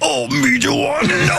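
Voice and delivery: high, slow mock wrestler shout; Macho Man as Meat Sauce. Host imitates a cohost through a wrestler, slow mock shout. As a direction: ham up a wrestler mimicking a friend. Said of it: "Randy Macho Man Savage doing an impression of Meat Sauce".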